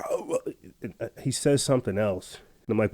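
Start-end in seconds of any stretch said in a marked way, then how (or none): 0.1-0.4 mocking the hiker. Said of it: "He's just like".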